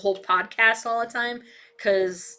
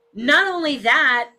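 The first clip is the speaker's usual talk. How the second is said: usual speaking voice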